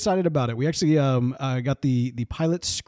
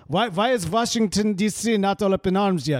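comic Russian spymaster; Russian accent; 3 semitones higher than usual. Pretend. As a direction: demand answers in a put-on Russian accent. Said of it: "Russian secret headquarters".

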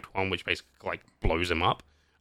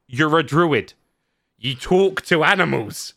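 loud, higher-pitched protest; mock incredulity; 6 semitones higher, 6 dB louder than usual. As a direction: point out the absurdity, exasperated. Acted incredulous retort to druid.

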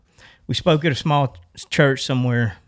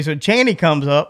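ordinary delivery, nothing marked

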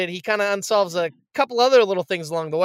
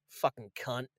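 low, very soft deadpan aside; dry deadpan; 8 semitones lower, 16 dB quieter than usual. Retold or imitated. Retelling the detective's insult in front of the girl, dry deadpan. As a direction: imitate a bone-dry, muttered insult. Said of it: "he just dry as can be".

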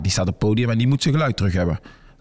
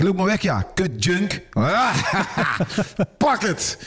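mocking taunt; 7 semitones higher, slower than usual. Taunting him up the stairs.

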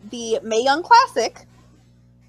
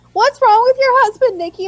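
high, mock-dismayed questioning; alarmed friends; 9 semitones higher, 6 dB louder than usual. People asking fan about her wrestler, mock alarm. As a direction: mimic people demanding an explanation.

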